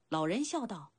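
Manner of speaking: plain reading voice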